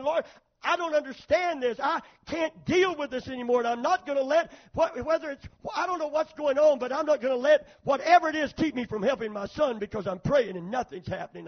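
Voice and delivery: loud, high desperate plea, acted out; impassioned retold prayer at full force; at wit's end. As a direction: cry out to God in exasperation. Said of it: "I was at my wit's end".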